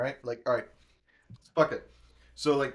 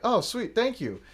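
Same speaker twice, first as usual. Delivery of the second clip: much higher, chirpy fake-sweet thanks; mock delighted; 9 semitones higher than usual. Thanking the manager for the cancelled bill, mock delighted. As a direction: play up the pleased surprise. Reacting to free meal.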